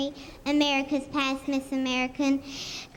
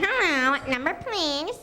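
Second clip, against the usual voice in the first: telephone operator. Acted. Lily shows the reporter her telephone operator character, mildly hammed up. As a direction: put on the old-time phone operator act, leaning into the hello. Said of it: "Do your telephone operator for Heather".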